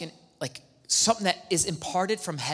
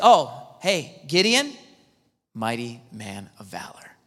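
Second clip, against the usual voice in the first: God calling Gideon; 5 semitones lower, 4 dB louder, slower than usual. Retold or imitated.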